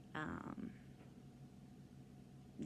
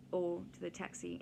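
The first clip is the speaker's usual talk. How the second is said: ordinary talk, nothing marked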